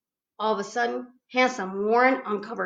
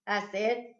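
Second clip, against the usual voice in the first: ordinary delivery, nothing marked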